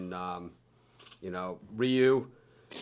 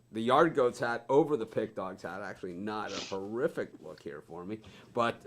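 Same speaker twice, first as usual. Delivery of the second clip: normal talking voice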